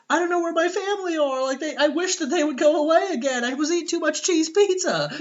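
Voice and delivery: put-on high, whiny little boy; high child voice, Kevin answering Snake; kid voice. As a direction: play the lost kid, high and innocent.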